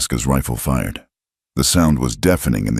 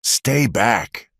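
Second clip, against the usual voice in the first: mild yell; 7 semitones higher than usual. Acted. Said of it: "Martha yelled".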